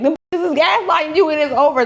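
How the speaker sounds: imitating a sassy fan. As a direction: dish it with attitude. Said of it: "she was like".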